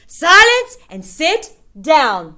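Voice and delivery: commanding witch. A narrator's reading.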